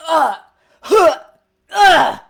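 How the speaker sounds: loud pained grunts of someone taking hits; combat effort grunts. Shown as a demonstration. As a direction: grunt and gasp as if stabbed and shot. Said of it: "those are efforts".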